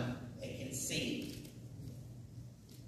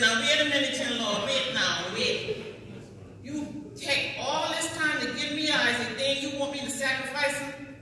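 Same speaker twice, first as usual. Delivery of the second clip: put-on loud, higher, indignant old mother; Sarah protesting; 7 semitones higher, 6 dB louder than usual. Teacher voicing Sarah objecting to God, loud and indignant. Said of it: "Sarah would have said".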